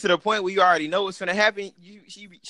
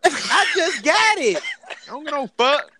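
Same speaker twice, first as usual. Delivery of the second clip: put-on whiny kid; 9 semitones higher, 3 dB louder than usual. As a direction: kid refusing to give up the controller. Kid fighting over video game.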